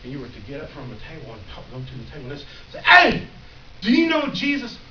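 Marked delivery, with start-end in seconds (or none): 2.8-4.8 much higher and louder, eager; eager call out. Retold or imitated. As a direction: pounce on friends at the table. Asking friends at table loudly.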